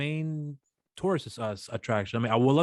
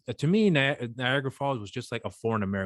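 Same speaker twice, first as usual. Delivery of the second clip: ordinary talk, nothing marked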